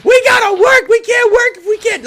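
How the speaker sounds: high, loud cracking shout near tears; desperate yell. Retold or imitated. Frustrated dad yelling at his son, voice breaking. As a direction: scream it out at the breaking point. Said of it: "I would yell at him".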